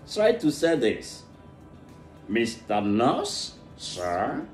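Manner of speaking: ordinary delivery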